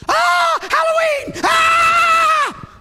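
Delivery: mock terrified churchgoer screaming at Halloween. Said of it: "Christians go".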